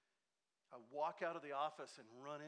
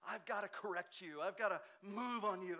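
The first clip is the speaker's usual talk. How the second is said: critical know-it-all; 5 semitones higher, 6 dB louder than usual. Retold or imitated. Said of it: "to them it's so righteous".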